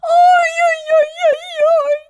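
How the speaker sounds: very high crying shout; hammed-up royal panic; panicked wailing. A narrator's reading. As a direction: squeal out the ow-ow-ows. Queen panicking over a pin.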